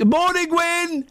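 calling out. Real, not acted. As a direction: call out to someone nearby. Calling out to wife in room.

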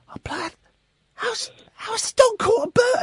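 high, baffled inner voice; inner disbelief. Retold or imitated. A dad seeing the dog with a bird, high and baffled. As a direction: puzzle it out in disbelief. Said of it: "And I'm thinking".

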